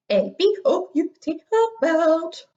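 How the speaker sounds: high sing-song silly spelling. Shown as a demonstration. Teacher spells for third graders in a high silly sing-song. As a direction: sing the letters up high, goofy. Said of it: "spell this word in a silly voice".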